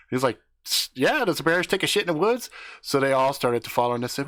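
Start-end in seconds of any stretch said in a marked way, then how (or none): 0.6-2.5 high, fast eager yes; quick squeaky eagerness. Retold or imitated. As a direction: blurt it out, keen to tag along. Eager dude answering.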